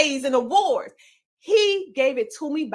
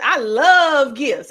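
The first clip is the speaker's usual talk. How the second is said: slow, louder I love gifts with love stretched; a hammed-up gift lover, louder and slow; mock gift lover; 7 dB louder, much slower than usual. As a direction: lean on love, slow and delighted. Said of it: "you're probably saying".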